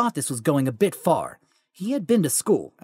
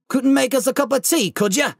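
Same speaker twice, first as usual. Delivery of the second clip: Hagrid voice; 7 semitones higher than usual. Acted. Giant asks for tea.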